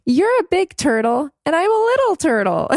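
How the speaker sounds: put-on high little-girl voice; child voice. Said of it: "she looks at me and she's like".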